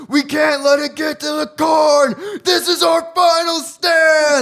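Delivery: hammed-up panicked yell of a crazy neighbor; crazy neighbor panicking. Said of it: "He's like".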